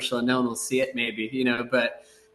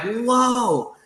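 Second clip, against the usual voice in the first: a much higher, drawn-out "Whoa"; retold amazement; 11 semitones higher, 3 dB louder than usual. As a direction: gasp it like a stunned intern. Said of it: "I'm like".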